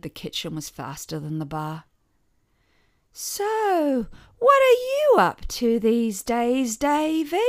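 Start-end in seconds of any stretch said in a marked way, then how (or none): 3.1-7.4 put-on old aunt. A narrator's reading. Aunt needling brother.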